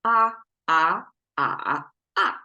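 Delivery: "ah" sung through the tones, high level, rising, dipping, falling; four Mandarin tones. Shown as a demonstration. Showing Western ears the four tones, "ah" in each Mandarin tone. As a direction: demonstrate each Chinese tone on a separate "ah". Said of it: "but four tones".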